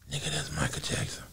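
breathless hushed whisper; awed whisper. Retold or imitated. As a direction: whisper it in total awe. Everyone awestruck at the reveal.

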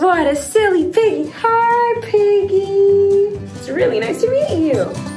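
put-on high sing-song toddler voice; reading to a toddler. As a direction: talk sweetly to a little child. Children's show host.

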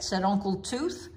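plain reading voice

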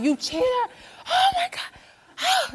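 high-pitched reenacted scream, last words drawn out; retold screaming. Woman reenacting catching her partner cheating, screaming. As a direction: wail the accusation in a mock scream. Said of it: "I just started screaming".

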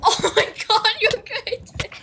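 high, giggly accusation; cracking up while catching a cheat; laughing disbelief. Real, not acted. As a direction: squeak it through laughter.

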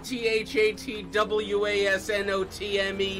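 each letter T H A T W A S N O T M E said separately, a bit slow; T H A T W A S N O T M E, spelled one letter at a time. Pretend. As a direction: spell it out letter by letter, mock insistent. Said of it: "I'm a good speller".

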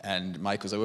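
normal talking voice

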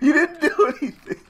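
laughing. Real, not acted. Gamer laughing in disbelief at the quitter, through laughter. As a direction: laugh while you say it. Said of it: "I'm laughing".

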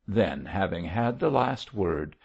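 ordinary delivery, nothing marked

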